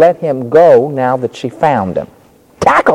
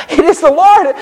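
excited disciples; 3 dB louder than usual. Acted. Disciples exclaim.

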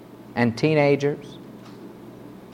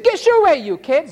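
mock disciples shooing kids away, pitched very high and louder; scornful disciples; 11 semitones higher, 3 dB louder than usual. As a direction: bark it like an annoyed gatekeeper. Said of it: "And they said".

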